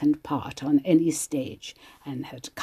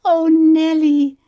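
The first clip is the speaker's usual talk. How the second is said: high, loud and helpless, drawn out slowly; helpless cry; 11 semitones higher, 9 dB louder, slower than usual. Retold or imitated. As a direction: wail her name, unable to help. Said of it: "said poor Kate helplessly".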